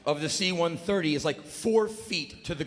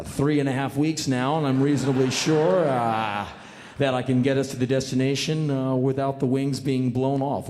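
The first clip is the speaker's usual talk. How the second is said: lower, drawling pilot announcement over the intercom; calm captain reassuring passengers; laid-back pilot; 4 semitones lower than usual. Acted.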